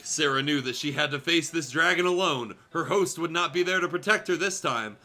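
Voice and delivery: usual speaking voice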